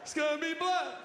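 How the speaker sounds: mock panicked laugh then high shout; imitating terror, voice jumping up high; scared. Comedian reenacting his fear of blood while alone. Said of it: "jerking off all scared".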